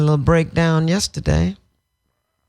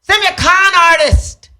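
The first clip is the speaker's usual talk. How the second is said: higher, louder, aggrieved demand; retold railing at God; 11 semitones higher, 4 dB louder than usual. Grieving daughter confronting God about the con artist, aggrieved. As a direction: shake your fist at heaven.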